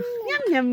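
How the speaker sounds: high chomping yum yum; munch like a hungry cartoon shark; playful shark yum. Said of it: "Anong sabi na".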